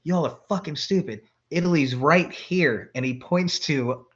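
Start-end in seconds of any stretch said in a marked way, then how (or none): 0.0-2.9 scornful know-it-all. Retold or imitated. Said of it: "he's like".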